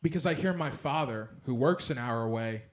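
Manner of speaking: usual speaking voice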